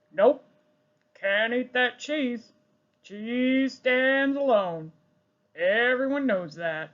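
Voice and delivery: slow, firm farmer voice; stubborn farmer. Acted. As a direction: lay down the law like a farmer. Farmer character speaks.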